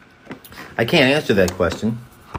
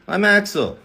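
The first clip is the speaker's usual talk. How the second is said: higher bright character take; Axel voice; 9 semitones higher than usual. Pretend. Trying Axel voice takes for chat. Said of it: "voice takes of a character".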